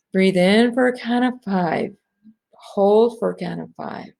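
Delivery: calm breath-count guidance; guided breathing. Acted. Leading the ladies through breath counts, calm guide.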